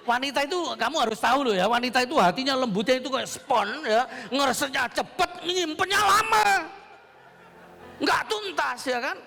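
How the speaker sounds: high, loud, climbing exclamation; comic preaching rant. Real, not acted. Preacher teasing congregation about women's memory, comic rant.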